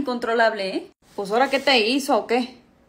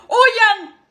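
loud mock-panic yell; panicked shout; 9 semitones higher, 6 dB louder than usual. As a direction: yell at everyone to run. Panicked escape call. (grito de pánico fingido)

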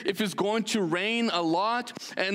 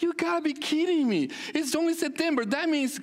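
exasperated disbelief; 7 semitones higher than usual. Retold or imitated. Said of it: "I was like".

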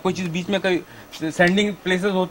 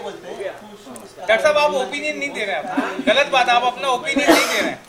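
laughing, higher teasing scold; playful laughing reproach; laughing protest; 4 semitones higher than usual. Real, not acted. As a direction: tease him through a laugh.

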